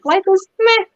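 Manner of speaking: mock a shrug-it-off answer in a lifted voice; apathetic meh. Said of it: "if their answer is".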